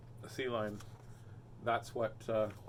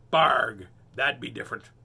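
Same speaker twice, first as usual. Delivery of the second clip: pirate grunt; 4 semitones lower, 7 dB louder than usual. Acted.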